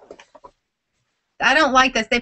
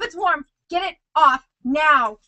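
ordinary talk

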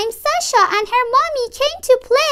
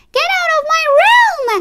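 put-on high, mildly raised child's shout; 6 semitones higher than usual. A narrator's reading. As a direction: yell it up high in protest. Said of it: "So I shouted".

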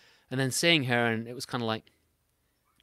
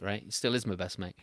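ordinary talk, nothing marked

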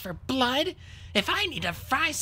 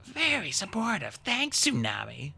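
understated dry character aside; wry sarcasm; 8 semitones higher, 3 dB quieter than usual. A narrator's reading. Peril privately answers Tsunami's grudging defense, wry. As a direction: thank them with quiet sarcasm.

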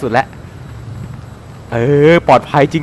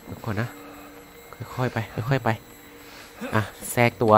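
whispering while hiding; 7 semitones lower, 16 dB quieter than usual. Real, not acted. Sneaking past monster.

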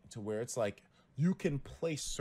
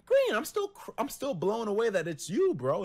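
high, loud excited disbelief; blown away; 10 semitones higher, 6 dB louder than usual. Retold or imitated. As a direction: still shrieking with amazement. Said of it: "I was like".

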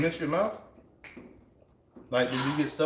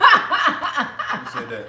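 laughing; 5 dB louder, much slower than usual. Real, not acted. Laughing at a quoted catchphrase.

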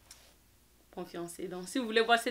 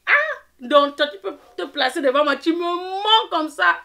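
loud, high-pitched accusation, drawing out the lie; indignant outburst; 9 semitones higher, 13 dB louder than usual. Retold or imitated. Narrator confronting her friend for lying to her face, indignant outburst. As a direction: shout it in outraged disbelief, re-enacting the quarrel.

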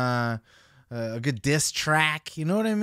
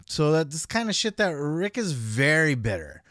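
ordinary delivery

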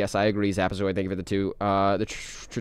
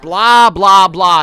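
loud, higher dismissive blah; mocking blah; 6 semitones higher, 13 dB louder, much slower than usual. Streamer drowning out the game voice, loud mockery. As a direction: drown out the voice you're ignoring.

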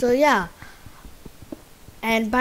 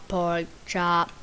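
ordinary talk, nothing marked

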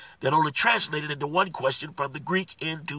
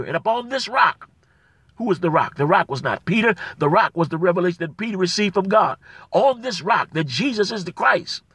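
loud, rising preacher's cry on Jesus Christ; preaching with fire; 5 dB louder than usual. Acted. Pastor exhorting his brothers and sisters, loud preaching. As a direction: proclaim it to the back row.

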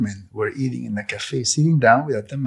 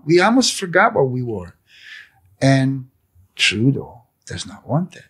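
ordinary talk

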